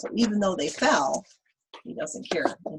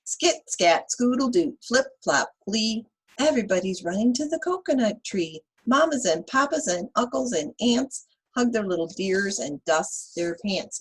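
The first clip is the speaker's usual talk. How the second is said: put-on lively read-aloud for kids; reading to children; 4 semitones higher, 5 dB louder than usual. Teacher reading Chicka Chicka Boom Boom to children, lively. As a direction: read the rhyme to the class with bounce.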